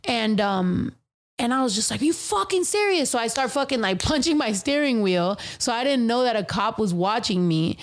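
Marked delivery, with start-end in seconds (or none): 2.0-3.1 retold, a high incredulous outburst; mild mock fury, pitched way up; mad outburst. Telling friends how she reacted to the drama, high mock anger. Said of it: "like, fucking mad".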